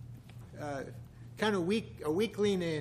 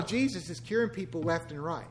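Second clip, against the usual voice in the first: ordinary talk, nothing marked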